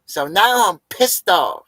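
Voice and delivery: high, loud put-on whiny voice; hammed-up offended whine; mock pit bull Nicole. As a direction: play a confused angry dog-woman. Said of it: "She's like".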